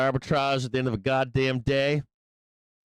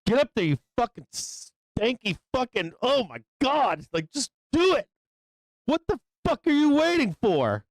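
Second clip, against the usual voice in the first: frustrated trader yelling at the screen; yelling at the chart; 7 semitones higher than usual. Real, not acted. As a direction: shout the price bar up. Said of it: "yelling at the fucking bars".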